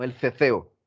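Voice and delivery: "ceceo" with both c's as th; Castilian th. Shown as a demonstration. Teacher naming Spain's sound for students, Castilian th. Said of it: "El ceceo es en Espa".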